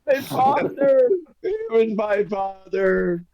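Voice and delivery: slow, high, breaking sobs; hammed-up sobbing. Barbarian wails to Ziggy, slow hammed-up sobbing. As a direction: drag it out, wailing for his father. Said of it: "He's crying now".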